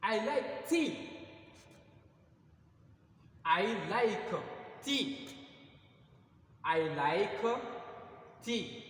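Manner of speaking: "I like tea" repeated loud and high; drilled example. Shown as a demonstration. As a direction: drill the class with the English example. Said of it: "teacher repeats the example". (répétition forte et aiguë de « I like tea »)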